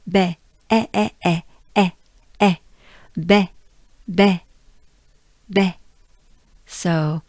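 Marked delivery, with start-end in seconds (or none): 0.1-5.8 "baie" with a short open "eh"; French vowel demo. Shown as a demonstration. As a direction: isolate "eh", then repeat "baie" firmly. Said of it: "Let's say baie".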